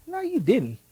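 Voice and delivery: high-pitched, disbelieving comeback; mock indignation. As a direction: play up being offended, pitch jumping high. Acted indignant thought at daughter's boyfriend.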